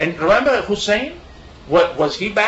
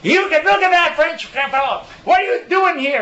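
much higher, mock-indignant retold outburst; imitating an irate guide; 11 semitones higher than usual. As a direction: play the offended guide, pitched way up. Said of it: "oh, the guy was".

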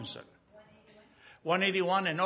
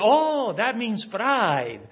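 high, loud, drawn-out "oh, that means pride"; excited realization; 7 semitones higher, 8 dB louder, much slower than usual. Retold or imitated. Retelling Ephraim's eureka to the congregation, loud and delighted. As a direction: stretch it out in delight as the penny drops. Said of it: "And he said".

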